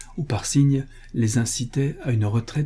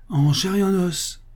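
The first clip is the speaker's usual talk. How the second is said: high, spirited exclamation; crying out; 8 semitones higher than usual. A narrator's reading. Bernard answering Paul's surprised question, crying out. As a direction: pipe up in eager reply. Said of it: "s'écria Bernard".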